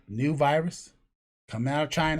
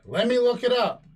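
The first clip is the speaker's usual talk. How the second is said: higher, calling through to another room; raised voice; 7 semitones higher than usual. Retold or imitated. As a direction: call it out across the house. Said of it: "that's why I raised the voice".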